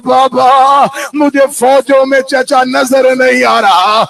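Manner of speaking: put-on declaimed child's plea to her father; retold tearful cry. Sakina cannot see her uncle, tearful declamation. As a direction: call out the plea in lament. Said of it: "Sakina رو کے کہتی ہے".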